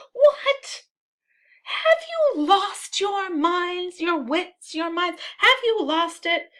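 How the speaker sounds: very high, incredulous cry; high-pitched, drawn-out indignation; outraged disbelief. Acted. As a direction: exclaim as if they have gone mad. Brabantio outraged at the shouters.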